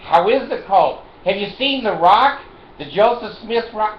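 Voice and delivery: loud, high taunting questions; mocking call-out. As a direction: shout the jeers up high at him. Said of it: "I go".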